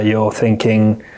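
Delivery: ordinary talk, nothing marked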